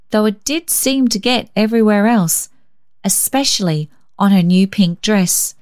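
ordinary delivery, nothing marked

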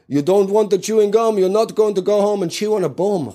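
high, sloppy mock Nigerian sales pitch; imitated Nigerian accent. Attendant late in the evening pushing gum, in a mock Nigerian accent. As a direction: push the sale in a high put-on Nigerian accent. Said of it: "he'd always get a little bit sloppy".

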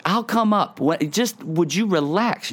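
high-pitched, mock exasperation; imagined exasperated parent. Dad wishing to answer daughter upstairs, mock exasperated. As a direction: let pretend impatience push the pitch up. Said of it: "I just wanna be like".

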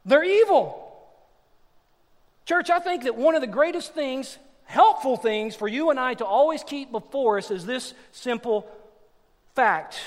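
loud, high, impassioned pulpit delivery; full-force preaching. Acted. Preacher exhorting the church about human evil, full-force preaching. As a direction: preach it loud and high, punching key words.

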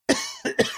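a single real cough; short dry cough. Real, not acted. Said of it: "Excuse me all for coughing".

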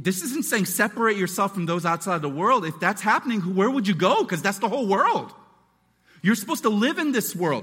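normal talking voice

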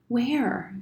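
lightly voiced young boy; quietly insistent boy. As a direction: press gently but firmly for an answer. Boy asks once more.

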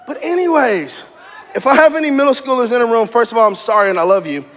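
usual speaking voice

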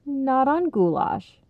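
slow, kindly correction; gentle correction. A narrator's reading. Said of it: "Ronnie's dad corrected gently".

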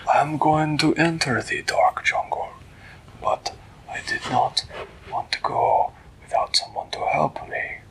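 husky, measured put-on voice; stately storybook cat; grave cat ruler. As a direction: declare the plan like a solemn king. Voices Flibbertigibbet stating his plan.

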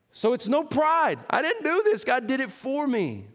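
full-force sermon delivery; fiery preaching. Acted. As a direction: preach it out to the whole room.

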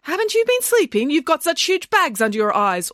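very high, mock-concerned imitation; mimicked rival nanny. Nanny imitating rival's snide remarks, mock concern. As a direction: imitate a backhanded well-wisher. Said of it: "followed it up with a comment like".

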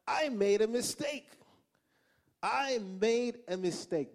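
high, emphatic mock lament; exasperated regret. Husband regretting marrying into mafia family, exasperated regret. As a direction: wail it high, drawing out the repeat. Retelling his panic about marriage.

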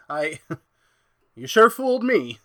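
laughing high and loud while talking. Acted. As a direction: laugh the words out, still astonished. Fooled by the amoeba, laughing.

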